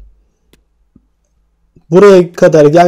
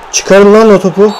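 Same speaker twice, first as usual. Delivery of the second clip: urgent yell; 4 semitones higher, 3 dB louder than usual. Real, not acted. Yelling to clear the ball.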